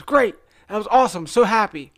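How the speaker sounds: acted-out delighted instructor; enthusiastic praise. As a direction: praise a student with high energy. Instructor praising him, retold.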